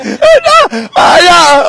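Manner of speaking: high, exclaiming outrage; scandalised, theatrical shouting; indignant rant. Real, not acted. Mock-outraged rant about parliament.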